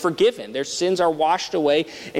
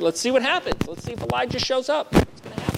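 much higher, sing-song jeering; taunting mockery; 9 semitones higher than usual. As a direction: needle him like a scoffing bystander. Taunting crowd at the crucifixion.

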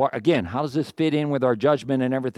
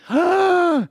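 high, sharp gasp of fake shock; mock shocked gasp; 3 dB louder than usual. Preacher mocks the outrage at the pastor, gasping. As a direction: gasp as if scandalised.

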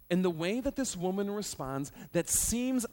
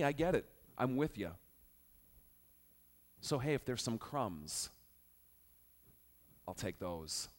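humble agreement; 3 semitones lower than usual. Retold or imitated. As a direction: give in gently, almost tender. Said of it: "The woman agrees with him".

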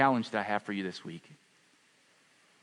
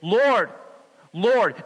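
pleading cry; 9 semitones higher, 8 dB louder than usual. Retold or imitated. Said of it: "many will say to me on that day".

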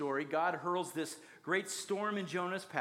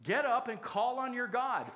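higher-pitched mock yell of an angry captain; imitated yell; 6 semitones higher than usual. Ship captain shaking Jonah awake, mock yelling. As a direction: yell it in imitation, pitched up but not full volume. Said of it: "he yells at him".

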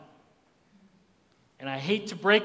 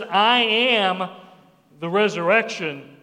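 "I am" held loud, then a pause before "the resurrection"; emphatic declaration; 4 dB louder than usual. Acted. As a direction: hold "I am" firmly, then pause. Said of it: "So when he says".

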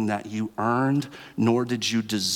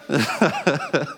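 laugh; 5 semitones higher than usual. Real, not acted. Laughs at own joke.

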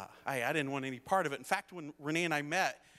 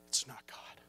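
low, near-whispered retold warning; hushed counsel; 3 semitones lower, 5 dB quieter than usual. Dad holding son by the shoulders, hushed counsel. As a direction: drop to a solemn hush.

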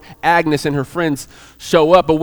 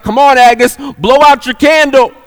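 higher, louder, prodding her along; uncomfortable urging; 6 semitones higher, 5 dB louder than usual. Retold or imitated. Diner owner urges Agnes to cut the cake, uncomfortably. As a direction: hurry her up, a touch impatient. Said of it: "he starts to get uncomfortable".

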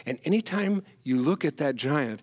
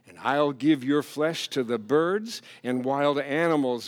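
ordinary delivery

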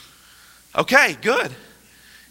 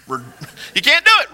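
laughing; 3 semitones higher, faster than usual. Real, not acted. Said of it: "You can't say that with a frown".

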